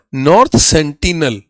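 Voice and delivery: North sentinel drawn out slowly, each word weighted; slow, deliberate teacher's repeat; slow emphatic answer. Shown as a demonstration. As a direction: spell the name out slowly for the class.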